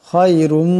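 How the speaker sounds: খায়রুম with a thick, full খ from the throat; heavy kha. Shown as a demonstration. Teacher showing students the heavy খ in খায়রুম. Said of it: "এই খাকে মোটা আওয়াজে পড়তে হবে".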